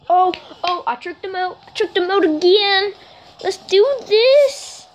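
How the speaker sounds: very high, thrilled squeal; freaking out. Real, not acted. Player tricking the goalie, high-pitched and wildly excited. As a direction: shriek with glee at tricking your opponent. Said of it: "I'm freaking out a bit".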